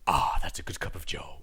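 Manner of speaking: low whisper of satisfaction; whispered contented coffee drinker; whispered savoring. Retold or imitated. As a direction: sigh it out after a sip.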